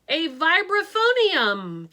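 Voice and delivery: loud, slow proud call; the tribe calling out the name of their invention. A narrator's reading. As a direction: announce it proudly to the class. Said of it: "the Cinnamons called out".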